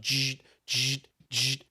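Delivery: buzzing imitation of a receipt printer; short buzzy bursts with gaps between them; printer noise imitation. Shown as a demonstration. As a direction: mimic a receipt printer spitting out orders. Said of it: "All you hear is this printing noise".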